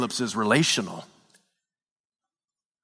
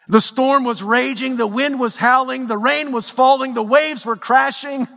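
high-pitched, driving sermon delivery; impassioned preaching; 8 semitones higher, 3 dB louder than usual. Acted. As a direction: build the pain line by line like a fired-up preacher. Preaching at full force.

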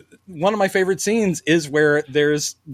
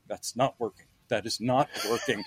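much softer, muttered to himself; muttered aside; 5 semitones lower, 10 dB quieter than usual. Retold or imitated. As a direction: mutter it under your breath, lightly imitating. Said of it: "like, going".

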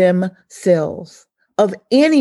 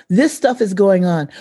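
ordinary talk

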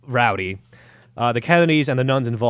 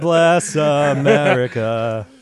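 slurred slow recital; 3 semitones higher, 5 dB louder, much slower than usual. Pretend. Mock lobotomized patient reciting.

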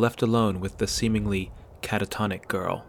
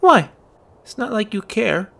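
sulky; 12 semitones higher, 5 dB louder than usual. Acted. Girl sulks at boy.

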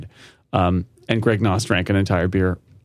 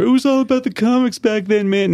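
laid-back hippie, pitched way up; 12 semitones higher than usual. Retold or imitated. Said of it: "I wanted to go to the hippie voice".

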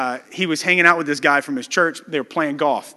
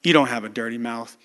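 much softer, lower, kind pastor's answer; gracious gentle reply; 7 semitones lower, 7 dB quieter than usual. Retold or imitated. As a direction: ease the man's shame softly. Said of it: "so graciously just smiled and said".

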